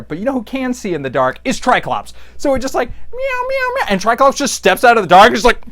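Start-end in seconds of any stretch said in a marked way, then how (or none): 2.9-3.9 high-pitched little meows; string of squeaky imitation meows; mock cat meow. Imitating the frightened cat in the dark.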